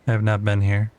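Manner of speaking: usual speaking voice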